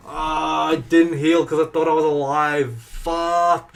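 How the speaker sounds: loud, high, furious yell; furious shout. Real, not acted. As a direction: blow up at your own mistake. Character dies, forgot to heal.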